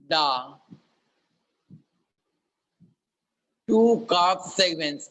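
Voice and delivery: slow, clear dictation with long pauses; dictating. Acted. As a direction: dictate each word slowly for note-taking. Teacher dictates the problem statement.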